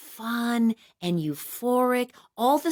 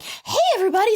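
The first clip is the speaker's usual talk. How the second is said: giddy greeting; 13 semitones higher, 4 dB louder, much faster than usual. Retold or imitated. Stoned cheerful greeting.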